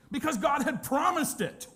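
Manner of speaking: high, loud burst of conviction; emphatic shout. Real, not acted. Preacher answering his own question, emphatic shout. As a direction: fire back the obvious answer.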